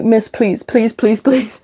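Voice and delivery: rising, begging please repeated; pleading pupil. Retold or imitated.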